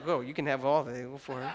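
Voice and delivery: low, soft giving in; hushed, comic surrender; mock smitten surrender. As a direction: play the husband melting at her request. Smitten husband gives in.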